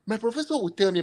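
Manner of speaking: ordinary delivery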